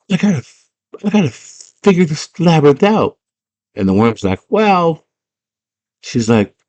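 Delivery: put-on high, frustrated girl; exasperated girl voice. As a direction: complain up high. Girl explains the maze.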